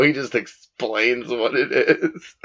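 ordinary delivery

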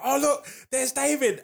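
high, slow, starstruck gush; a fan greets a celebrity, high and thrilled; excited fan. Retold or imitated. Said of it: "everyone would be like".

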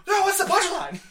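loud, high, laughing protest; laughing exasperation. Retold or imitated. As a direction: demand it through giggles. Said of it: "I'm like".

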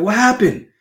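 surprised. Retold or imitated. Latecomers arriving late, shocked it's over, high mock surprise. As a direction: play the stunned latecomer, high and startled.

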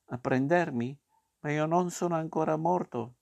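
high, mildly alarmed puppet voice; alarmed protest. A narrator's reading. Puppet to the rabbits come to take him, alarmed protest. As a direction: object in rising disbelief, lightly alarmed.

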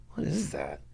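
hushed, whispered question; retold baffled whisper. Watching police dump blue packages, puzzled whisper.

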